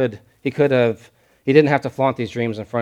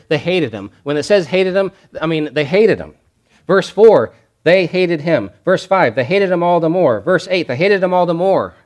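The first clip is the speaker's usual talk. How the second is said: "hated" punched louder each time, falling; hammering hated; 6 semitones higher, 5 dB louder than usual. Shown as a demonstration. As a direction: drive home the word "hated" over and over. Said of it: "Notice the emphasis here".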